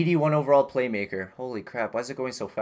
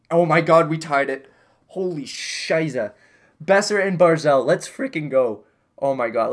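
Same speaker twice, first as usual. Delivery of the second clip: loud, higher cheering at the tie; excited celebration; 3 semitones higher, 6 dB louder than usual. Real, not acted. Gamer cheering the late tying goal, loud and thrilled. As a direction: let it rip, the comeback is on. Team ties game in third.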